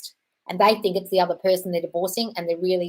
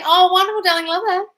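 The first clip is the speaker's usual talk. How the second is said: gushing delight; 12 semitones higher, 7 dB louder than usual. Acted. Acting SP's gushing reply.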